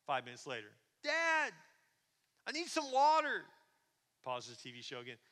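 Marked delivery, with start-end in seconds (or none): 1.0-3.4 high, loud pleading call; acted child shouting for a parent; kid hollering. Little boy calling down to dad for water, high and loud. Son yells down once more.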